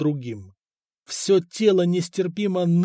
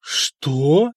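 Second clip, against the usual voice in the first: astonished; 4 dB louder than usual. Acted. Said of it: "изумлённые мужи".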